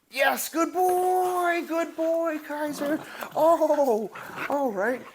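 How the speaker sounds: very high, slow, gushing praise; puppy praise. Real, not acted. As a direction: squeal of delight for the dog.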